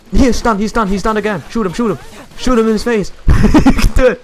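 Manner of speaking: loud, high excited callouts; yelling encouragement to the team; cheering someone on. Real, not acted. Cheering teammates in boss fight.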